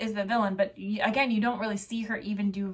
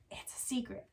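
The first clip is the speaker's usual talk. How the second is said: hushed, conspiratorial whisper; stage whisper; 14 dB quieter than usual. Pretend. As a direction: whisper it like a secret. Confiding a secret to listeners.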